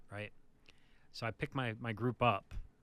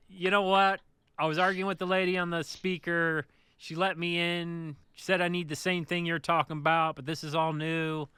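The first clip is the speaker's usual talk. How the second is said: louder, higher protest; raised, fed-up complaint; retold exasperated argument; 6 semitones higher, 6 dB louder than usual. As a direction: re-enact arguing with a gate operator. Retold argument with gate operator.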